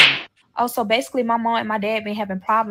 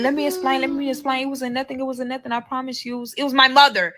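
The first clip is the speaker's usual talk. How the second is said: mock frantic pleading, higher, running on without a break; pleading to explain; 4 semitones higher, faster than usual. Boyfriend explains himself while she packs, mock pleading. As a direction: imitate someone scrambling to explain himself. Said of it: "he was trying to explain".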